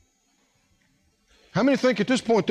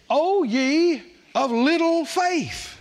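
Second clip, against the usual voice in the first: slow, higher, drawn-out rebuke of Jesus; mild rebuke; 6 semitones higher, much slower than usual. Retold or imitated. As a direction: stretch it out like a gentle scolding. Preacher voicing Jesus' rebuke.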